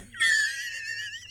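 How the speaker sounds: very high, wheezy real laugh. Real, not acted. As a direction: crack up in a high squeal. Laughs at own villain line.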